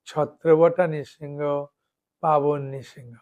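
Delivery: slow, measured chant of the names; slow recitation. Shown as a demonstration. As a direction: recite each name like a morning prayer. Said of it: "আপনি বললেন".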